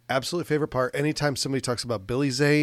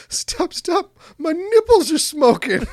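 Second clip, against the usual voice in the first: high, loud comic yell; 9 dB louder, slower than usual. Retold or imitated. Retelling a movie sex scene to a co-host, yelling. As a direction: imitate a panicked movie yell, pitched way up. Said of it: "he's yelling".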